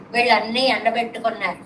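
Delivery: ordinary delivery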